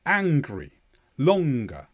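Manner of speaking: "Angry", "longer" with a clear g after ng; voiced middle g. Shown as a demonstration. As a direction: sound a hard g inside "Angry" and "longer". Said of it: "you can hear a clear g sound".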